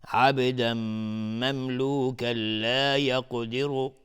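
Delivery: slow chant, عبداً merged into مملوکاً with a held nasal m; merging with ghunnah. Shown as a demonstration. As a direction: hum the m joining عبداً to مملوکاً. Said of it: "تنوین بعدش میم ادغام با غنه".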